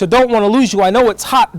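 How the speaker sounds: ordinary delivery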